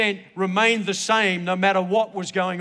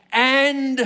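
"and" drawn out, higher and loud; stress on AND; 7 semitones higher, 8 dB louder than usual. Retold or imitated. Preacher quoting Paul's promise to the jailer, "and" emphatically stretched. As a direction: punch and stretch the word "and".

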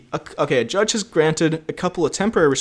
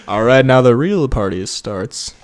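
McConaughey impression; 5 semitones lower, 5 dB louder, slower than usual. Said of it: "It's like".

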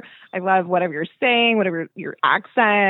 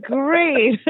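high, starstruck agreement; a smitten, high "great"; dazed yes; 7 semitones higher than usual. Retold or imitated. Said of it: "I'm like".

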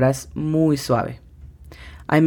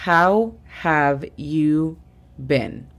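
"How have you been?" muy despacio, palabra por palabra; slow English model; much slower than usual. Shown as a demonstration. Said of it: "la tercera frase del día sería". ("How have you been?" muy lento)

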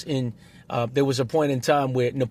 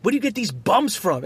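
heckler's insult; 8 semitones higher than usual. Retold or imitated. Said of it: "somebody was just like".

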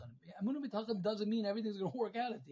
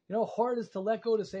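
ordinary talk, nothing marked

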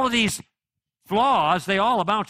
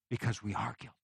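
hushed punchline; 6 semitones lower, 11 dB quieter, faster than usual. Real, not acted. Drops to the sermon's key point.

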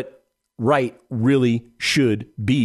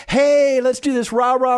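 much higher, loud, mock rah-rah pep; mock hype cheer; 12 semitones higher, 3 dB louder than usual. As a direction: parody a pumped-up seminar huckster. Mocking hype seminar pitch.

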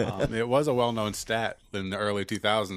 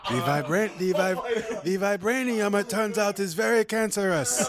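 high-pitched mock African accent; mock Wakandan accent; 7 semitones higher than usual. Joking that vibranium is cancerous, high mock Wakandan accent. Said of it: "because Wakanda isn't a real place".

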